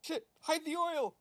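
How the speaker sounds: hammed-up alarmed cry; mock panic. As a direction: yell it like locals spotting invaders. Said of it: "it's more like".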